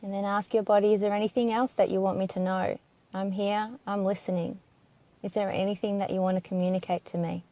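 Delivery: hushed, even inquiry with long silences; guided meditation. Acted. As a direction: gently put each question and leave room for an answer.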